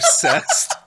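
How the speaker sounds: squeaky laugh on the word; can barely get the word out for laughing. Real, not acted. Friends talking about fan fiction, laughing through the word. Finishes genre name laughing.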